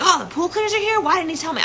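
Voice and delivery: very high, astonished questioning; high-pitched mock indignant surprise; retold surprised mother. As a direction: imitate mom's surprise, voice shooting up. Retold mother learning the secret.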